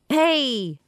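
shout. Acted. Grit shouting down from his ladder. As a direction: hail them from up the ladder. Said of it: "Grit is shouting".

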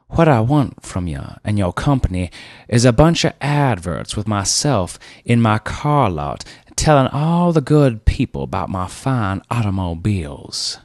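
put-on steamroll the young man; bossy car dealer. Car dealer Rivers telling the ad man to shut up, overbearing.

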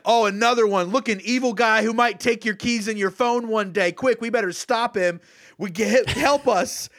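high, hammed-up panic; mock alarm. Fake rescuers warning the crowd of an evil guy, mock alarm. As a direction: play up a fake cry for help. Mimicking alarmists pointing at a villain.

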